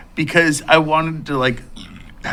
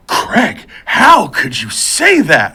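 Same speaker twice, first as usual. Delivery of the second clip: loud, drawn-out mock outrage; appalled scolding; 5 semitones higher, 7 dB louder, much slower than usual. Shocked mom to Craig over his advice, mock-appalled scolding. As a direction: imitate an appalled mom telling him off.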